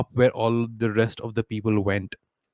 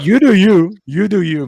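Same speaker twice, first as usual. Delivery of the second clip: teasing; 8 semitones higher, 11 dB louder than usual. Real, not acted. Teasing the player.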